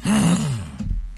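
sharp mock-offended gasp. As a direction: gasp in fake outrage.

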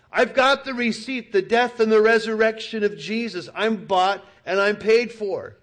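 high, loud, triumphant cry; loud high defiant proclamation; defiant yell. Pretend. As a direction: shout it back at the devil. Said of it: "You should yell".

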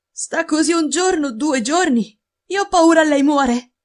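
put-on high, worried servant girl; frightened maid. A narrator's reading. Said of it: "disse la ragazza".

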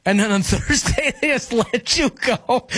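can't help laughing while speaking; amused, laugh breaking into the words. Real, not acted. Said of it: "Sorry, I shouldn't be laughing".

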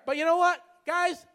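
ordinary delivery

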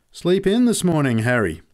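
high, loud call falling on the name; teasing jibe. A narrator's reading. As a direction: chip a latecomer, calling it out. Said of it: "He chipped the blokes coming in late".

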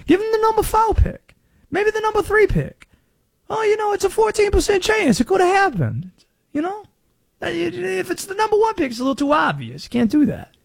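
Conspiracy Josh's put-on Southern accent. Radio host doing a Southern accent as Conspiracy Josh. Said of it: "where did this accent come from".

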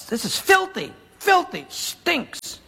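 disgusted. Acted. Naaman disgusted at muddy Jordan.

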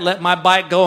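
ordinary talk

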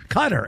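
Qatar as "CUT-er"; "Qatar" with a weak second syllable; stress on first syllable. Shown as a demonstration. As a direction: say Qatar like the word cutter.

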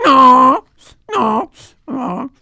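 high, loud, whiny bray of no; braying donkey. Acted. The donkey refuses the grandfather, high whiny bray.

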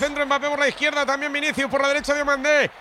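urgent, rising football commentary; radio match commentator in full flow; live play-by-play. Real, not acted. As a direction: call the attack as it builds toward goal.

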